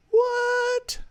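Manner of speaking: very high falsetto exclamation; hammed-up, drawn-out high surprise; mock amazement. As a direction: squeal it in playful disbelief. Mock amazement at getting checks.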